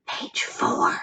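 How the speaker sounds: breathy unvoiced whisper. A narrator's reading. Todd whispering to Joy in class, unvoiced whisper. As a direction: hush it so the teacher can't hear. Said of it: "Todd whispered".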